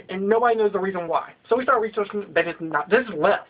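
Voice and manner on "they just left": ordinary talk, nothing marked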